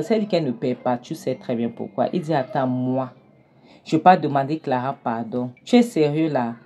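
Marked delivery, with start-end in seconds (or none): none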